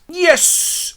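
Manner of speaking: very high, loud cheer; triumphant yes. Acted. Actor given the role of Pyramus, thrilled. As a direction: squeal it like a winner. Bottom gets the lead role.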